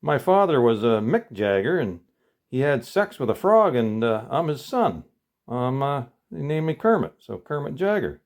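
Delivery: proud little frog explaining his father. Acted. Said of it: "He says".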